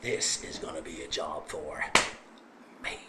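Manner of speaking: the devil muttering that it's a job for him; ominous whisper. Acted. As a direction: menace in a hush. The big devil speaks ominously.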